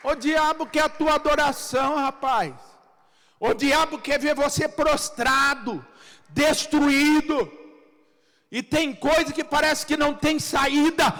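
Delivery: high, driving preacher's shout; loud, high declaration, phrase after phrase; fervent preaching. Real, not acted. Preaches at full force.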